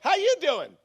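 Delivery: high, loud, peppy retold hello; upbeat greeting. Upbeat customer greeting the lady at the cart, cheerful. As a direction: greet a stranger with hammed-up cheer. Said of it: "I'm really an upbeat person".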